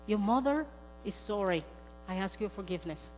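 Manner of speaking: sincere apology. Retold or imitated. Mother asks her grown daughter's forgiveness, sincere. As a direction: ask forgiveness gently.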